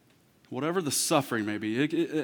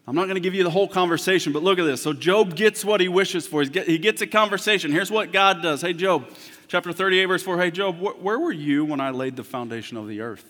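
rising, louder preacher's voice; preaching; 4 semitones higher, 6 dB louder than usual. Acted. Preacher building to the church, louder and higher. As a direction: preach it out to the congregation.

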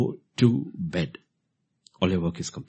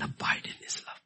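whisper; 7 dB quieter than usual. Real, not acted. Scripture quote ends whispered.

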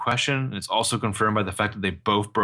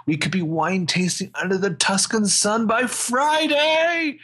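higher, slow, dreamy oversold sweet talk; overselling romantic pitch; 10 semitones higher, slower than usual. Retold or imitated. Ezra wooing Arya with an oversold Tuscan getaway, hammed-up. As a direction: pour it on like a smooth talker selling a fantasy. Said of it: "goes back to overselling this".

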